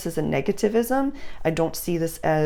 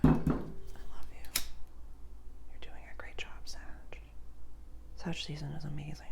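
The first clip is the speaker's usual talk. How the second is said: very soft, whispered affection; hushed whisper; 12 dB quieter, faster than usual. Real, not acted. Reader signing off to Sagittarius with love, hushed whisper. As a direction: whisper it lovingly, barely audible.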